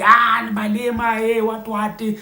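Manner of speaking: mock insulting. Commenter insulting Malema instead of debating, mock jeering. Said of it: "it's not about insulting".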